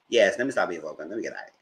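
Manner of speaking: ordinary talk, nothing marked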